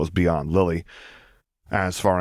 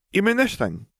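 put-on girl's voice, high; 13 semitones higher than usual. A narrator's reading. Lily shows scar.

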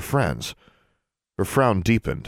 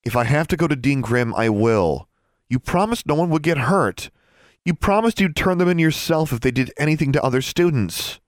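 high, heated reproach; reproachful insistence; 9 semitones higher than usual. A narrator's reading. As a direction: hold your brother to his promise. Sister threatens, reproaches brother over promise.

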